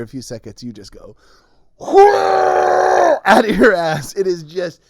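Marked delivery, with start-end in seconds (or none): 1.8-3.2 loud high whoosh burst; explosive blast. Retold or imitated. Retelling the colonic, a loud blasting noise. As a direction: imitate the sudden gush, loudly.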